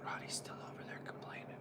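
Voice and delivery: hushed fast whisper. Real, not acted. Vlogger quietly comments on Roddy, whispering. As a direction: whisper it as an aside. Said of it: "hushed aside".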